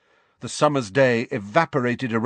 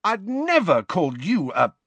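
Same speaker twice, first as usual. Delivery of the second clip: high, indignant denial; yelping protest; 9 semitones higher than usual. A narrator's reading. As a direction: protest in disbelief, voice pitched up. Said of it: "yelped James".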